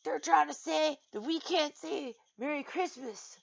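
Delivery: hammed-up indignant whine; freaking-out complainers. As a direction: imitate people in a huff. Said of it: "People are going around freaking out".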